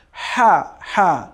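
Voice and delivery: হা with a soft rasped breath from mid-throat. Shown as a demonstration. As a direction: breathe out হা as Arabic ح. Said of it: "দ্বিতীয় হচ্ছে soft হা".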